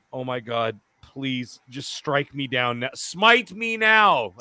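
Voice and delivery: slow, drawn-out plea to be struck down; pleading inner prayer. Retold or imitated. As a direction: beg the heavens, dragging the words. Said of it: "as Titan would think".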